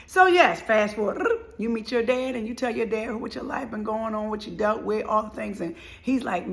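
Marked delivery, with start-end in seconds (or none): none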